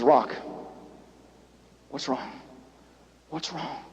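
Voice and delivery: low, very soft asking; hushed worried question; gentle concern. Retold or imitated. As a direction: friend asking softly. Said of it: "He says".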